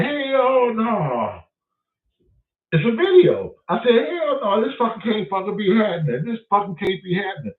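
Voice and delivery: high, strained cry of disbelief; shocked disbelief. Retold or imitated. Homeowner finding marijuana in his basement, cracking with shock. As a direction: voice cracking, can't believe it.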